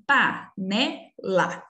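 "panela" slowly split into pa-ne-la; syllable by syllable. Shown as a demonstration. Teacher reading to young pupils, "panela" slowly syllable by syllable. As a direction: separate each syllable of "panela" with a gap. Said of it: "falar devagarzinho pra vocês tentarem encontrar as sílabas".

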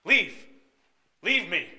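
loud, high angry shout; angry order. Acted. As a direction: shout it as a furious dismissal. Teacher throws student out after audition.

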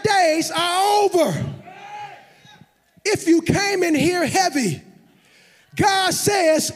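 full-force preaching. Real, not acted. Preacher calls the heavy-hearted congregation to arise, shouting.

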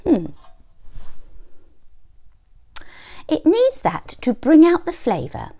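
high, loud bossy hen. A narrator's reading. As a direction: order him about sweetly. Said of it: "snapped Mother Hen".

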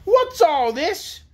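high, slow, imperious demand; harsh demand. A narrator's reading. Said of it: "demanded a harsh voice".

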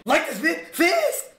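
high, loud, slow bossy yell; hammed-up commanding yell ending in a laugh; demanding shout. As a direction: drag out the order like a tyrant, then laugh. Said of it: "I said fast".

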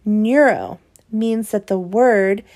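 ordinary delivery

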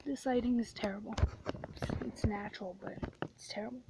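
fast, unvoiced whisper. Real, not acted. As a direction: grumble it in a quick whisper. Whispered complaint about lighting.

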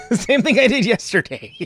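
laughing it off. Real, not acted. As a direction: chuckle through it. Laughs at himself.

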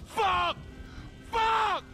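yelled cursing, rising on the second; angry outburst. Acted. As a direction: explode in frustration. Frustrated outburst after setback.

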